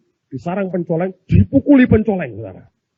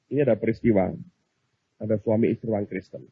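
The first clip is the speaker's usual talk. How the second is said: ordinary delivery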